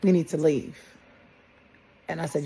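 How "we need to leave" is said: a mild mock holler up the stairs, louder on the last words; retold holler. Son calls his mother from downstairs, mild mock holler. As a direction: call urgently from downstairs, voice rising at the end. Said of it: "I heard him holler".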